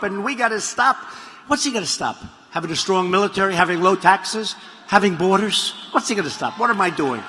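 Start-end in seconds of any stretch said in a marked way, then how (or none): 0.2-1.0 mock Bernie rant. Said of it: "Bernie Sanders was ranting and raving".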